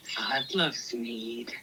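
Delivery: low, slow, hushed mock quote; imitating co-host. Teasing how Tracy started the conversation, hushed imitation. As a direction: drop to a drawn-out, whispery impression. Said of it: "Tracy starts off this conversation with".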